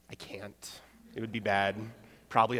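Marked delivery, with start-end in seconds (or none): 0.1-0.8 under-breath aside. Real, not acted. Muttered aside about the accent.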